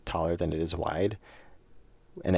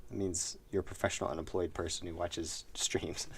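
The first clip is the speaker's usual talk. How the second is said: sly whispered joke; whispering; 8 dB quieter than usual. Real, not acted. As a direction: glisse la blague tout bas. Whispered joke about viewers.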